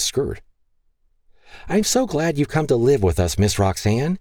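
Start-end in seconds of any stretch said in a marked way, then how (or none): 1.7-4.2 put-on little girl. Grace hugging Roxanne's skirt.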